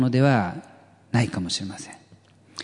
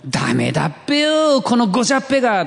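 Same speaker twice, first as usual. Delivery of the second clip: higher, louder and fast mock teacher's scolding in Ibaraki dialect; 11 semitones higher, 4 dB louder, faster than usual. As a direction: bark it out like an old Ibaraki schoolteacher telling a kid off. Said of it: "先生にですね、あの、怒られた". (茨城弁で先生が叱るように、高く強く早口で)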